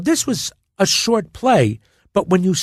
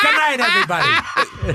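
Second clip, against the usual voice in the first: mock sign-off; 11 semitones higher than usual. Mock sign-off after impression bit.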